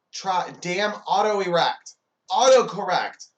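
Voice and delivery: high, loud and drawn-out frustration; exasperated correction. Acted. Acted frustrated correction of texting mistake.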